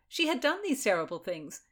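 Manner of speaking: usual speaking voice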